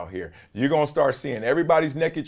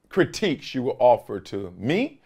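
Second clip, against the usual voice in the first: ordinary talk, nothing marked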